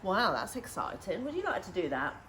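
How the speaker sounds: plain reading voice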